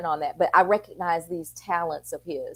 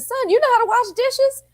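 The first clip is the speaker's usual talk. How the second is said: high, louder disbelieving question; amazed mom; 5 dB louder than usual. Retold or imitated. Mom questioning her son about dishes, high and amazed. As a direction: pitch it way up in mock astonishment.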